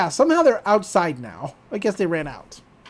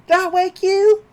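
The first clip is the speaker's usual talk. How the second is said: higher, sugary villain taunt; mock Gideon taunt; 10 semitones higher than usual. Gideon taunting the Pines family, higher and sweetly smug. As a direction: needle the family from on high.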